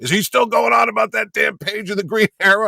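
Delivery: high, loud exasperated scoff; scoffing retort. Retold or imitated. Artist scoffing that his rival still complains, exasperated shout. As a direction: scoff at the old grudge. Neil scoffs at Denny's grudge.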